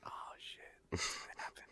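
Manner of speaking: hushed, mock-terrified aside; scared whisper. Cat searcher fearing stranger, scared whisper. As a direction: whisper it as if dreading an attack.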